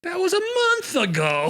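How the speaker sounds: high, loud incredulous protest; indignant disbelief. Retold or imitated. To the cop, disbelieving high protest. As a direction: squeak out the outrage at the cop. Retelling arrest, indignant reply.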